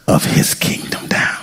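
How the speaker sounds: hushed whispered ending. Acted. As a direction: drop to a whisper for effect. Dramatic drop in sermon.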